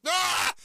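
sudden high, loud mock wail; anguished cry. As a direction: yelp in mock despair at no contact. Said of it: "And I was like".

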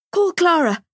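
high, loud urgent order; a parent in a panic, calling out. A narrator's reading. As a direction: snap the order out in alarm. Child hurt, calling for help.

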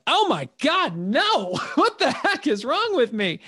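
high, laughing exasperated outburst; shocked disbelief. Retold or imitated. To himself on day 198, high laughing disbelief. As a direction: throw your hands up at yourself, pitch way up.

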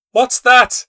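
high startled cry of fear; frightened cry. Acted. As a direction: cry out in sudden alarm. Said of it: "cried the old woman".

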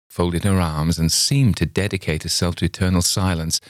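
plain reading voice

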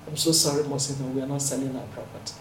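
soft refusal. Retold or imitated. Quietly refusing the sale.

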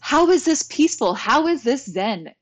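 high, loud mock frustration; half-joking exasperated rant; frustrated outburst. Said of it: "I remember getting so frustrated".

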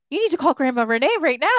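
high, loud, rapid imitation of urging; retold urgent insistence. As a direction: rush it out as an excited order. Retells husband's excited urging.

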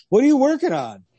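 high, loud nosy visitor. Retold or imitated. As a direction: pop in high and loud, curious. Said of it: "being like".

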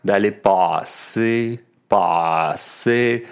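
"passer" with a drawn-out, doubled back vowel; exaggerated Montréal vowels. Shown as a demonstration. Teacher exaggerating "passer" so students notice the vowel. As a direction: stretch "passer" slowly, Montréal style. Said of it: "J'exagère un peu pour que vous le remarquiez".

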